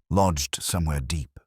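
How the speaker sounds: ordinary talk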